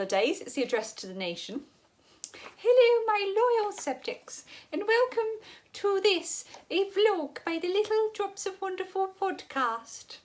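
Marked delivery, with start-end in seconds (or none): 2.6-10.2 mock-regal posh English, high and drawn out. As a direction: put on a slow, high, posh royal address. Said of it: "say podcast in a posh voice".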